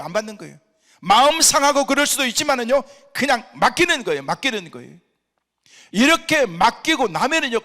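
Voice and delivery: loud, high, driving sermon voice; full-force preaching. Real, not acted. Pastor exhorts the congregation about not taking stress, loud preaching.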